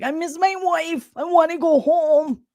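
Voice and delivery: Boston cop. Retold or imitated. Host imitating homesick Kenny in a Boston accent. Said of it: "Kenny the cop from Boston who was like".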